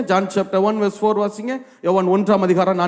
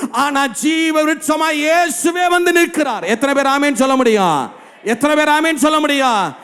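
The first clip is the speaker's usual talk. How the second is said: high-pitched proclamation rising to a shout; fervent preaching; 4 semitones higher than usual. Acted. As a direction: proclaim it to rouse the congregation. Preaching at full force.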